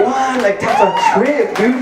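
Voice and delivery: mock shout of amazement at the crowd; stunned disbelief. Comedian retelling his shock at the crowd, high disbelieving shout. Hearing of a crowded garage show.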